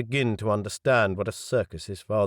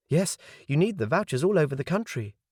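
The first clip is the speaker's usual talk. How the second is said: high, chirpy waitress voice raised well up; serving girl voice; 7 semitones higher than usual. Acted. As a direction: play the polite young waitress. Waitress answers the question.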